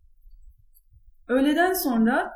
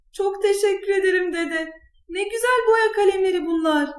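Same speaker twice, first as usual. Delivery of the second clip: put-on high-pitched, excited child's voice; delighted thanks; 7 semitones higher than usual. A narrator's reading. Granddaughter thanks grandpa for crayons, delighted. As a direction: gush over a present in a high voice.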